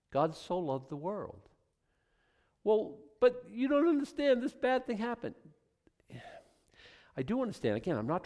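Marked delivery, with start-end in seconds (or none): 2.6-5.3 high, whiny protest; protesting objection. Retold or imitated. Upset listener objecting to the preacher, high and whiny. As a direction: push back, sure you're not heard.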